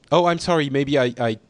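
normal talking voice

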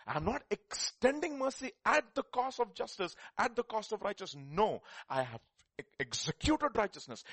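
high, loud proclamation of God speaking; preaching God's words. Acted. Preacher voicing God to the church, loud and high. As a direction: thunder it out as the voice of God.

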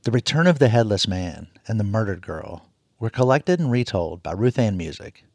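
usual speaking voice

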